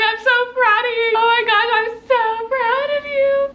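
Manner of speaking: high, tearful gushing that stretches out; happy crying. Retold or imitated. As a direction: imitate a friend wailing with pride. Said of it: "She starts crying".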